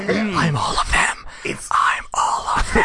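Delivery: high raspy bird creature voice; screechy griffin rasping; raspy voice with eagle shrieks. Acted. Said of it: "[name] doing the [name] voice".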